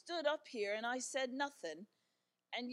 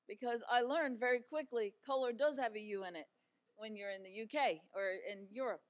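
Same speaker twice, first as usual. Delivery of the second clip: ordinary delivery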